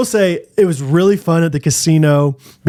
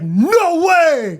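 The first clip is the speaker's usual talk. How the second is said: high-pitched mock amazed outburst; freaking out; 12 semitones higher than usual. Fan recognizing an internet comedian at the casino, freaking out. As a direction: imitate a stunned fan freaking out. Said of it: "Oh, he was freaking out".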